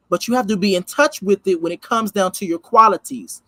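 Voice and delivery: ordinary talk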